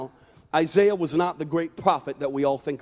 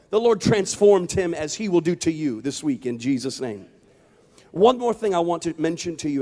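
usual speaking voice

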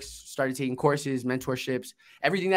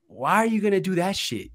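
higher-pitched old sergeant impression; Gunny Thompson; 5 semitones higher than usual. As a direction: mimic a blunt old gunny from New Orleans. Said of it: "he talked like this".

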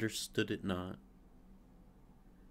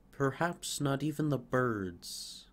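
ordinary delivery

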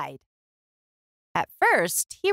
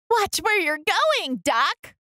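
much higher, scolding hen voice; fussy chicken; 10 semitones higher than usual. A narrator's reading. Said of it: "what she thought was".

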